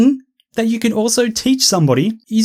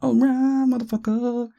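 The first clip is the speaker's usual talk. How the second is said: mild mock high voice; 5 semitones higher, 5 dB quieter than usual. High mocking quip.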